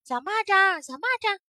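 high, loud calling to a friend. Acted. Firefly calls after the hopping grasshopper, high and loud. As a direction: call out across the grass. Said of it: "萤火虫就叫着".

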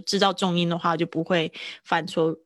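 ordinary talk

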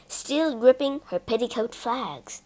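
ordinary delivery

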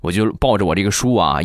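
ordinary delivery

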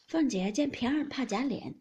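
usual speaking voice

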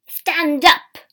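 bellowed command to get up; loud command. A narrator's reading. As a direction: shout it as a harsh order. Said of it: "said the shopkeeper loudly".

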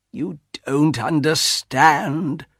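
slow, drawn-out regret; sad. A narrator's reading. Husband gives up arguing with wife, sad and slow. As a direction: stretch the words in helpless sorrow.

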